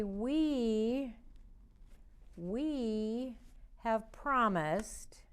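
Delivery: slow, word by word, for signing along; slow signing recital. Shown as a demonstration. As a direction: stretch each word for the signs. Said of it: "do this in sign language".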